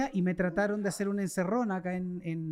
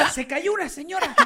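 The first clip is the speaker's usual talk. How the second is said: put-on high, half-laughing kid voice; retold child's report; 8 semitones higher than usual. Child telling mother about the fallen lady, high laughing imitation.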